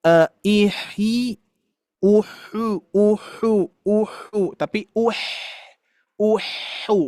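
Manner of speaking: ihi and uhu slow and soft, the h taking the vowel; wrong vowel h. Shown as a demonstration. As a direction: demonstrate the mistake slowly. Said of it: "ketika kasroh jangan sampai".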